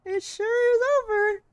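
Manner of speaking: high, loud hammed-up wail of despair; mock dramatic wail. Streamer hams up the phone joke, high and loud. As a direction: overact the line like a tragic hero.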